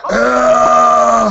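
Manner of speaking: loud high battle yell; war face scream. Pretend. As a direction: roar out a fierce war cry. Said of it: "Let's see it again, Sam".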